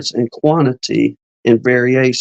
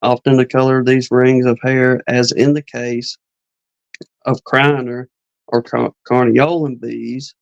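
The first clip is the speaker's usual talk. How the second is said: normal talking voice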